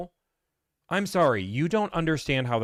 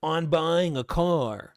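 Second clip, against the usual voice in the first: slow, higher mock of a smug seller; mocking smug know-it-all; 4 semitones higher, slower than usual. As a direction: drag it out to ridicule him. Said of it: "another guy that said".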